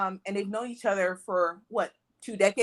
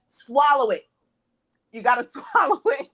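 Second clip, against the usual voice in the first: loud call rising high, with a laugh; retold yell; 4 semitones higher, 5 dB louder than usual. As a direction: yell it at the screen in a cinema. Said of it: "I yelled in the theater".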